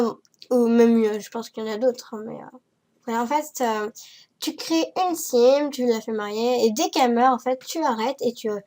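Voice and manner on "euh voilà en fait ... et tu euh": ordinary talk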